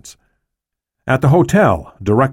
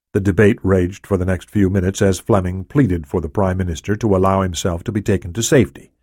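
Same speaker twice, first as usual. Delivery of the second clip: ordinary delivery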